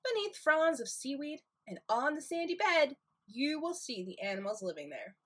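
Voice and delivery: put-on gentle storybook reading for kids; reading to children. Librarian reading a tide pool book to children, gentle storytime voice. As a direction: read the book aloud to little ones.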